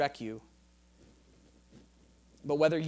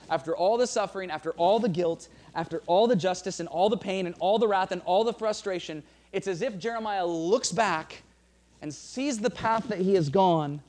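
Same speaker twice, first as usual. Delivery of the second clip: rising, louder preaching; swelling sermon climax; preaching build; 3 semitones higher, 5 dB louder than usual. Acted. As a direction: build the list with force.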